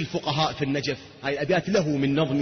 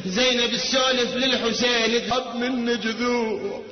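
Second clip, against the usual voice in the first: higher, fervent sermon storytelling; impassioned preaching; 6 semitones higher than usual. Acted. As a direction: preach the story with rising fervour. (خطابة حماسية بصوت مرتفع)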